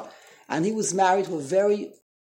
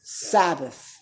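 normal talking voice

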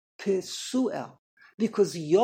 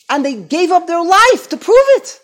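much higher, loud fiery sermon; preaching at full force; 9 semitones higher, 14 dB louder than usual. Acted. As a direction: drive it home from the pulpit. Preaching peak.

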